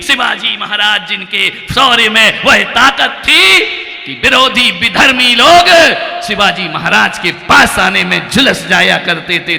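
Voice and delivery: a monk thundering his challenge from the stage; preaching at full force. Real, not acted. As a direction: rise and roar each promise at the crowd. Heroic sermon climax.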